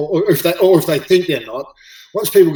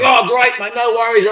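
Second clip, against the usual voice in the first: much higher and louder, a burst of relief; sudden high relieved cheer; relieved exclamation; 9 semitones higher, 5 dB louder than usual. Retold or imitated. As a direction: imitate the coach's delighted relief. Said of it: "And he was like".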